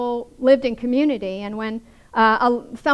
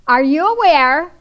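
pointed reminder; 5 semitones higher, 7 dB louder than usual. Retold or imitated. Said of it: "she wants to remind Jesus".

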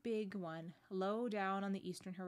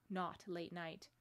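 ordinary delivery

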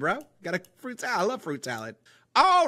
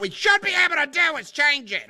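higher-pitched sudden burst mid-explanation; sudden outburst; 6 semitones higher, 4 dB louder than usual. Pretend. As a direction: blurt it out high in an odd voice. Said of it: "I got Tourette's".

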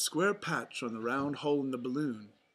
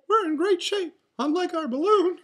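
very high, loud falsetto mouse; rally friends in a cartoon falsetto; Mickey Mouse; 10 dB louder than usual. A narrator's reading. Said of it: "said Mickey".

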